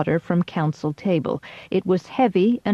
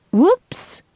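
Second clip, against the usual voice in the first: high, loud startled squeal; a sudden shrill yelp of surprise; 11 semitones higher, 5 dB louder than usual. Acted. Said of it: "I squealed".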